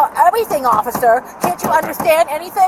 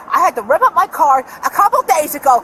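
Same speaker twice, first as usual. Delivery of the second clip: usual speaking voice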